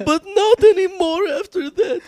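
high, loud, slow whine; mock tearful plea. Suspect reassuring the cops, mock tearful plea. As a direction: plead in a hammed-up cry.

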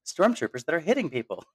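high-pitched delighted ooh; light up with gleeful surprise, pitched well up; retold elation. Fan recalling stormtroopers finally hitting people, elated high exclamation. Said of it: "I was also elated".

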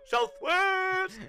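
slow, stretched out "Southwest!"; drawn-out call. Shown as a demonstration. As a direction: draw the word "Southwest!" out long and slow. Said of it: "we need the megaphone, uh, emoji".

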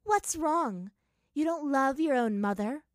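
loud, high, mocking questions; taunting. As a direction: goad her with feigned concern. Twisted double taunts Azula.

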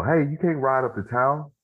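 lightly voiced protesting mother; mom trying to stop him. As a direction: imitate a mom calling after her child. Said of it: "my mom trying to stop me".

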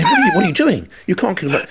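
high-pitched mock fussing; pompous producer. Producer scolding over a nickname, pompous. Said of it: "producer was a little bit pompous".

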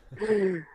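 real laugh breaking out; can't hold the laugh in; burst of laughter. Real, not acted. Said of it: "Chase, stop making me laugh".

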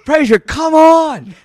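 high, loud, drawn-out call; imitated summoning shout. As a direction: holler it slowly as the friend calling you over. Willie calls him over, retold.

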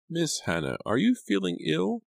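high, worried demand; demanding housekeeper. A narrator's reading. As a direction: press her, voice up high.

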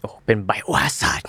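slow hushed whisper; awed whisper. Pretend. Co-host reacts to the game name, slow awed whisper. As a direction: breathe it out in awe.

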